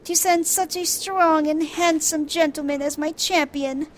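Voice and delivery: breezy, brushing off his worry; Arabella voiced.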